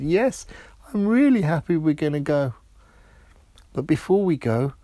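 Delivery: put-on high, bright-eyed delighted girl voice; excited princess. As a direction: squeal it out, thrilled and quick. Princess's happy reply to friend.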